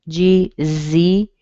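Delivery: G-Z said slowly, letter by letter; sounding out letters. Shown as a demonstration. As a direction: draw out G-Z as two long letter names. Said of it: "that makes the X".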